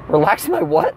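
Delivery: ordinary talk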